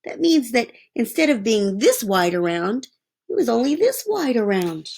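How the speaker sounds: ordinary delivery, nothing marked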